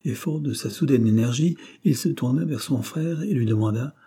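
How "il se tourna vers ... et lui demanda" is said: ordinary delivery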